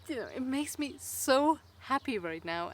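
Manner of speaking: ordinary delivery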